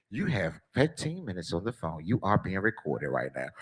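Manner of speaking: low, soft recorded announcement; automated prison phone. Retold or imitated. Prison phone system to caller, flat recorded announcement. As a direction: read it like the jail call robot. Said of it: "jail phone recording".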